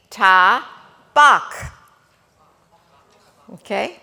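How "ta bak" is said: Ta then bak, with bak pushed up and louder; sounding out. Shown as a demonstration. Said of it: "both ah sounds".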